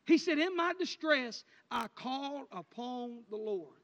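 loud, slowing, climactic scripture cry; full-force preaching. Acted. Preacher proclaiming the psalm to the church, loud then slowing. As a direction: build the psalm to a slow, ringing close.

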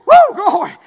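high, loud whoop of praise; joyful shout. Real, not acted. Preacher praising God mid-sermon, loud and high. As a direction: cry out glory to God.

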